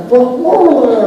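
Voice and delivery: high, loud monster hello; chicken monster. Acted. Actor turning to greet another cast member, high monster voice. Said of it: "using that voice".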